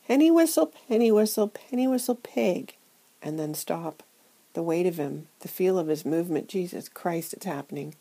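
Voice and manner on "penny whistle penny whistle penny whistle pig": louder, higher nervous retry; trying again, louder. Acted. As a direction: push the rhyme out louder this time. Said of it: "I try again".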